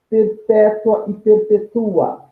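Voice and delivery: ordinary talk, nothing marked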